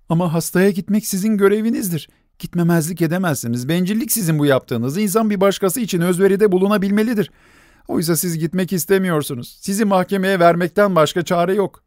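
high, fast accusing; desperate scolding. A narrator's reading. Nelli accusing the doctor, high and fierce. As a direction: threaten him in desperation.

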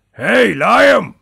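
booming high call to a dog; shouting. A narrator's reading. Old hunter calling his dog across the forest, shouting. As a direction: holler it out with full voice. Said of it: "diye bağırdı".

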